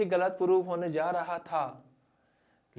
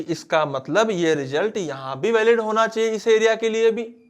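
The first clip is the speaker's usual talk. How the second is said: loud, high, emphatic declaration; insistent argument; 6 semitones higher, 10 dB louder than usual. Retold or imitated. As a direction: press the point forcefully at raised volume. Quoting Maxwell's forceful argument in court.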